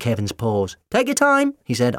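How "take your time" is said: high, urgent cry while dodging; high-pitched call. A narrator's reading. Doctor dodging the creature's paws.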